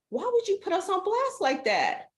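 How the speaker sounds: high mock-offended whine; mock offended viewers. Voicing viewers who feel called out, mock offended. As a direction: play the miffed viewers calling her out. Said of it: "get mad at me and go".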